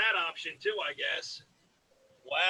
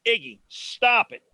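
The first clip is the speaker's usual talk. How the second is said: scolding the dog; 4 semitones higher, 9 dB louder than usual. Real, not acted.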